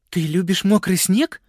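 stunned question. A narrator's reading. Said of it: "ошарашенно спросила Лу".

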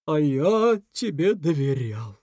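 slow, hurt reproach; bitter. A narrator's reading. Juniper reproaching the beaver he trusted, bitter. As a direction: draw it out with bitterness. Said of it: "горько произнёс можжевельник".

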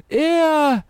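high desperate call; shouting. A narrator's reading. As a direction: cry out the name into the dark. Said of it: "закричала вдруг я".

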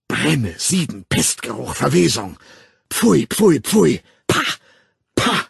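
building to high, loud spitting; violent disgust. A narrator's reading. Raging man alone with his verse, violent disgust. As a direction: list the horrors, then spit out revulsion.

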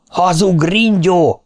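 slow, high-pitched insult; furious yell. A narrator's reading. Martin yells back at Nancy, high angry shout. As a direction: yell it out, stretching the words. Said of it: "ordította Martin".

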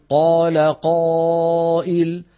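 long drawn heavy alif in قال قائل; slow demo. Shown as a demonstration. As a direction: stretch the alif in قال قائل full and heavy after the qaf. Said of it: "لما أنت بتقول".

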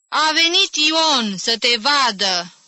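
slow, high call into a deaf ear; loud shout. A narrator's reading. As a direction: shout it slowly so the old woman hears. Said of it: "strigă tare în urechii tanti Mali".

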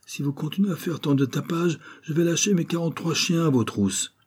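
vieil homme hargneux qui menace, voix plus aiguë; menace irritée, plus aiguë. A narrator's reading. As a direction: chasse le visiteur d'un ton aigu et hargneux. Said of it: "dit une voix". (vieillard qui menace un intrus, voix aiguë et hargneuse)

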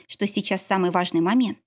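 ordinary delivery

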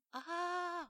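high wailing cry; wailing scream. A narrator's reading. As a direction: wail out in shock. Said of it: "возвопила я".

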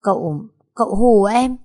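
put-on girl stammering at the young master who scared her; stammering question. A narrator's reading. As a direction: stammer it out, still shaken. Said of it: "lắp bắp hỏi".

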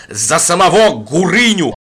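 plain reading voice

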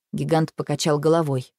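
usual speaking voice

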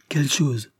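mildly mocking repeat of Quelle chose; ironic echo. Sophie mocking Ravensbird's question, ironic. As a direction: toss back Quelle chose with a light ironic lift. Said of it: "Répéta Sophie ironiquement".

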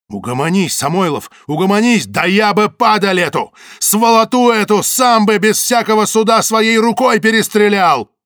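loud, high heated shout; calm him, then erupt yourself; rising anger. A narrator's reading. Zaytsev shouts back at Samoylov, loud heated.

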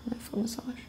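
soft whispered plea. Real, not acted. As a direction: whisper the question hopefully. Whispered repeat of the request.

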